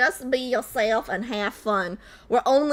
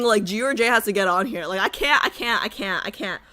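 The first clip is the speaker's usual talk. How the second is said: ordinary delivery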